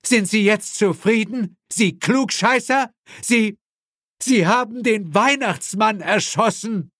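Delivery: high-pitched, indignant outburst; outraged accusation. A narrator's reading. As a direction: accuse him, voice pitched right up. Outraged father accuses the shooter. (empört, hohe Stimme)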